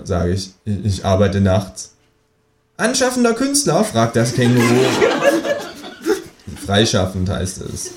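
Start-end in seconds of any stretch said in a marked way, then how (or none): 2.8-3.8 high, cheeky kangaroo voice; a smug kangaroo teasing. Acted. The kangaroo needles the narrator, in a high, cheeky voice. 4.7-6.2 high, mocking cackle; kangaroo cackle. The kangaroo mocks the artist with a high cackle.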